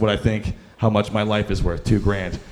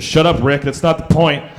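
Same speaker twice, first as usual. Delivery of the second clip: loud, higher, snappy retort; mock snap; 4 semitones higher, 7 dB louder than usual. Friend snapping at Rick to shut up, loud. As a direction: snap back at the guy, irritated.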